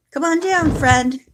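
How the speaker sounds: high, loud coaxing call to the cat; high calling to a kitten; coaxing pet voice. Real, not acted. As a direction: sweet-talk the cat down from up high.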